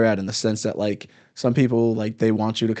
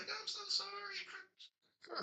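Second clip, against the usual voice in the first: very high, soft, barely voiced mock-mom; the mom apologising again, thin and breathless; meek mom; 27 dB quieter, much slower than usual. Repeating the mom line.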